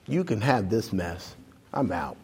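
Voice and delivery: very soft, low giving up; defeated. Retold or imitated. Pastor quitting on God, soft and defeated. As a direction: let it drop in despair.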